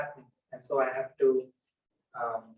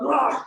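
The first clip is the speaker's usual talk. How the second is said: loud, high-pitched dog bark "rah"; sudden loud growly bark imitation; mock dog snarl; 11 semitones higher, 8 dB louder than usual. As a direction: lunge out like a snapping dog. Imitating an aggressive dog at comers.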